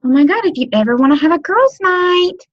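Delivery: put-on high, excited girly gush; bubbly casual pitch; gushing invite. Shown as a demonstration. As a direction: gush like it just popped into your head. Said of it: "just nonchalantly mention".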